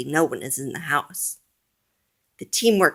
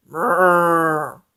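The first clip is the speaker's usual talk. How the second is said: monster grumble; 5 dB louder than usual. A narrator's reading. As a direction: rumble like a monster. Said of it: "says the monster".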